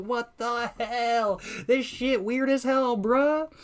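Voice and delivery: very high, drawn-out comic disbelief; hammed-up retold shock. Streamer replays his reaction for viewers, high mock-horrified wail. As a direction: wail it slowly in mock horror.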